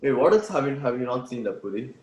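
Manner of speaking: ordinary talk, nothing marked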